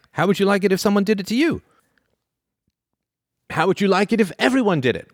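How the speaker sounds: high, sing-song lecturing parent; parent scolding. Retold or imitated. Parent taking Bob's tablet, mock lecturing lilt.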